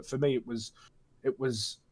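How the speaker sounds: ordinary delivery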